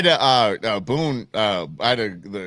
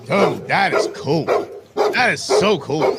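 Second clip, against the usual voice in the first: impressed; 5 semitones higher, 4 dB louder, slower than usual. Real, not acted. Admiring the video.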